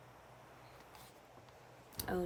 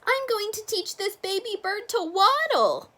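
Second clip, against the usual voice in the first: duck voice; 12 semitones higher, 6 dB louder than usual. Acted. Duck's voice.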